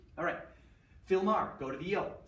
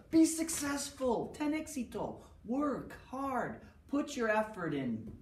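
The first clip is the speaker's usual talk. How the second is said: eager cheer; 7 semitones higher, slower than usual. Acted. Teacher cheering students on, high encouraging call. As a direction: pump the class up, voice raised high. Teacher urging class to succeed.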